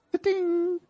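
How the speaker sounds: high chime ta-ding; sung jingle ta-ding. Pretend. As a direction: sing a little jingle. Jingle sound effect voiced.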